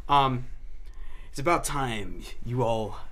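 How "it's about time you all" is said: low, ominous villain announcement; a hint of a gloating supervillain. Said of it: "Why do you say it like a supervillain?".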